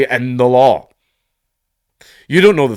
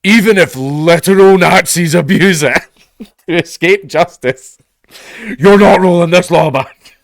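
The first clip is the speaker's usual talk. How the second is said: raised, insistent mock activist voice; hammed-up stubborn leftist; mock leftist protest; 6 semitones higher, 6 dB louder than usual. Leftists refusing to roll back the law, mock indignant. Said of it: "Even the leftists are like".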